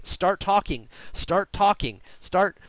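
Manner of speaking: high, insistent repeated urging; mock impatience. Listener fed up with a rambling guest, mock impatience. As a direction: push each repeat as if urging a slow talker on.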